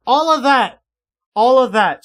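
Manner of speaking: loud, high, punched exclamation; emphatic outburst. Real, not acted. Fan ranting about a character's troubles, emphatic outburst. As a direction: hammer each word out in exasperation.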